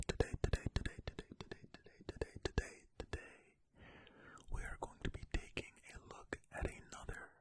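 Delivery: usual speaking voice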